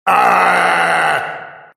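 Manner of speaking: long, loud, high howl; drawn-out shriek of a pursuing monster; monstrous howl. A narrator's reading. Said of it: "Вопль приближался". (протяжный чудовищный вопль)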